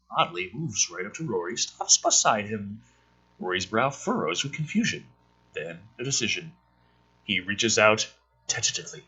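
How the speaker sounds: reading a screenplay aloud, a touch dramatic; lightly dramatic screenplay read; script reading. Acted. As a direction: read the scene headings and action like a narrator.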